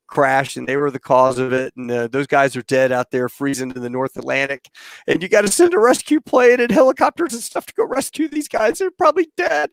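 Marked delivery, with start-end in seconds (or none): 5.1-9.7 very high, frantic retold plea; mock panic. Duped airman demanding a rescue at base ops, panicky. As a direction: plead urgently in a high voice.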